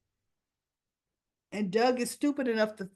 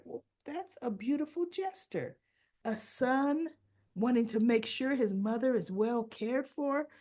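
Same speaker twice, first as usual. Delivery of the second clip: high, softened gushing over a kind gesture; imitated charmed wife; 5 semitones higher than usual. Wife hears of a son's care for his mother, imitated as touched. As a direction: play her touched, lifting the voice high.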